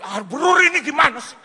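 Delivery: high, loud complaining shout; exasperated protest. Retold or imitated. Pastor running after the driver, exasperated high shout. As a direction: shout in comic exasperation while chasing.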